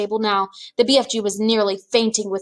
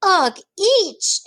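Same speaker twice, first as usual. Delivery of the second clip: disgusted spit; 10 semitones higher than usual. Acted. Giant spitting out vegetable.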